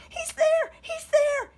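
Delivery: high panicked shout. A narrator's reading. Monster runs to mommy, high loud panicked shout. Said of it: "he shouted".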